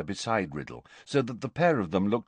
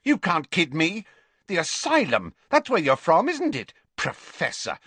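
high, tense voice, accusing and defiant; furious young Riddle; 10 semitones higher, 4 dB louder than usual. A narrator's reading. Riddle furiously accusing the visitor of coming from the asylum, high and defiant. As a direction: spit it at him like a cornered boy. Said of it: "looking furious".

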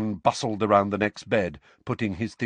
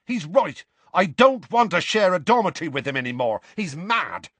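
high-pitched, indignant outburst; heated; 8 semitones higher, 4 dB louder than usual. A narrator's reading. Seamus refuses to share with Harry, heated. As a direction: declare it hotly, voice raised. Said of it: "said Seamus heatedly".

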